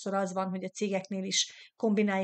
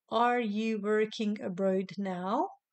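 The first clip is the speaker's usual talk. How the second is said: "Are you working abroad now?" said very slowly, every word spaced out; slow model repeat; much slower than usual. Shown as a demonstration. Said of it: "itt a külföldön elé nem kell prepozíció".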